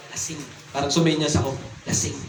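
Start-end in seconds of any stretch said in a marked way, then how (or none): 0.1-0.4 hushed, low-pitched one-word tip-off; retold confidential undertone; discreet aside. Discreetly signalling the baker about drunk. 1.8-2.2 hushed, low-pitched one-word tip-off; retold confidential undertone; discreet aside. Discreetly signalling the baker about drunk.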